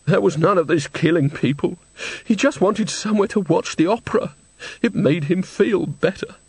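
put-on high, plaintive old woman's voice; tearful old woman. A narrator's reading. Old woman defends the opera-watcher to Nanny, tearful. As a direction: defend him, upset and high-pitched.

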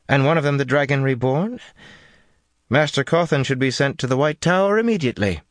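high, firm, commanding woman's voice. A narrator's reading. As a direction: insist with cool authority. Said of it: "Jolene said firmly".

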